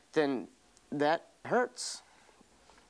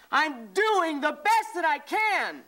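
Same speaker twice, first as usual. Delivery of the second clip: raised pleading shout; 9 semitones higher, slower than usual. Real, not acted. Husband keeps crying out to his wife, slow pained shout. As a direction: stretch the words out in pain. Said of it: "Stay with it".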